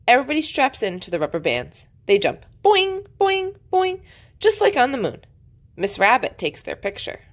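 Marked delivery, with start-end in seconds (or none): 2.6-4.0 high, springy boings; playful cartoon spring sound; bouncy boing noises. A narrator's reading. Imitates bouncing on rubber bands.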